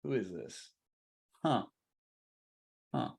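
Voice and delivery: very soft, low wondering to oneself; puzzled thinking. Acted. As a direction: think aloud with long pauses, barely voiced. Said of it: "they start thinking".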